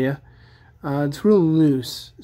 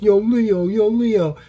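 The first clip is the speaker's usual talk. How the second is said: put-on light New York accent calling Leo, a bit higher; a playful New York accent call-out, slightly louder; 6 semitones higher, 4 dB louder, slower than usual. Raph calling to his brother Leo, in a light New York accent. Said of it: "Raph was always so New York".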